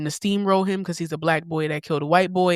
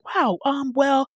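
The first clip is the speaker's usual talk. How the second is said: higher-pitched imitation of a condescending interviewer; mock condescension; 7 semitones higher, slower than usual. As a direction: mimic someone talking down to another. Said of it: "talking to that girl like she was dumb".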